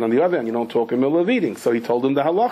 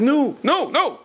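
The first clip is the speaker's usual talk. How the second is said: much higher repeated "no"; lightly mocked brush-off; imagined curt refusal; 11 semitones higher, slower than usual. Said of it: "he could have answered".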